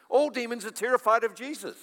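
ordinary talk, nothing marked

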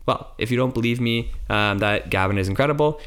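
normal talking voice

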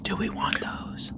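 hushed whisper to the party. Real, not acted. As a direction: whisper it so the shopkeeper can't hear. Conspiring aside at the shop counter.

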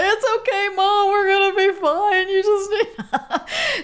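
high, wavering words through tears and a laugh; crying reassurance. Retold or imitated. Daughter comforting her crying mom, voice breaking through tears. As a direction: comfort her while you're choking up. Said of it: "and then I'm crying".

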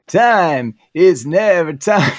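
slow, loud, drawn-out nasal drone. Pretend. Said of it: "I posted one that was like".

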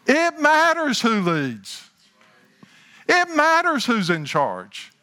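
loud, high-pitched preaching, words stretched out; getting loud. Real, not acted. Preacher telling the congregation who leads matters, getting loud. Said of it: "I'm getting a little loud".

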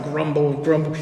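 grumbling mutter. Shown as a demonstration. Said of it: "It is a low-grade murmur of negativity".

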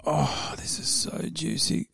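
gleeful slow half-whisper; gleefully reading gossip. Real, not acted. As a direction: savour it in a hushed whisper. Gleeful over a juicy bet.

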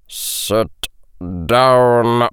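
slow, word-by-word menace; controlled, hissing teacher's order; hissed command. A narrator's reading. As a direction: space out each word in cold anger. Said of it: "the potions master hissed".

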